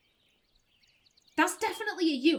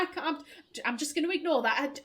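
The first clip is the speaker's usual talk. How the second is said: ordinary delivery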